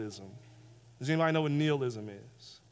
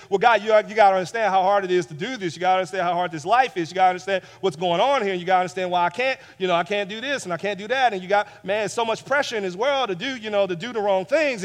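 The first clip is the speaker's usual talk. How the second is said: fast, pleading run of excuses to God; rushed excuses; 4 dB louder, faster than usual. Acted. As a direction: rattle off every excuse without a breath. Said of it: "You say".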